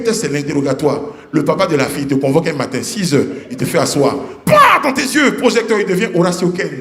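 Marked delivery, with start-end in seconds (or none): 4.5-4.8 loud high sound-effect pah; mock spotlight switch sound.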